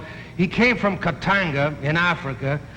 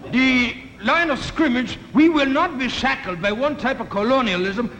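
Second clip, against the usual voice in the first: put-on African diplomat accent; 6 semitones higher than usual. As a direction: speak like a grand diplomat with a put-on African accent, drawing out the words. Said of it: "the guy from Katanga says".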